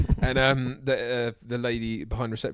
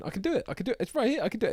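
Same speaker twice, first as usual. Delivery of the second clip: high, eager repeated plea; a lightly voiced eager worker; eager mock voice; 12 semitones higher, much faster than usual. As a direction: keep insisting, pitched high. Voicing the guy down the hole.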